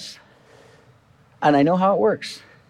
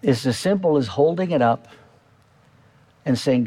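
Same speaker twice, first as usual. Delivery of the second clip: usual speaking voice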